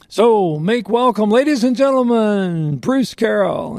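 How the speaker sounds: high, drawn-out stage introduction; big emcee build-up, stretched and high; radio announcer introduction. Acted. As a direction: hype the crowd like an announcer bringing on the star. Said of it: "radio guy out there on stage introducing me".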